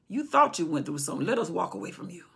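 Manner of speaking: usual speaking voice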